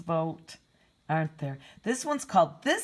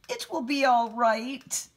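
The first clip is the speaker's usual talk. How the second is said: comforting; 6 semitones higher, 4 dB louder than usual. Acted. Friends comforting Bear in story.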